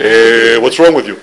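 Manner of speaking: low, understated Israeli-style grumble; Hebrew-speaking GPS. Acted. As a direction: lightly mimic an Israeli GPS complaining. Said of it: "Maybe in Hebrew".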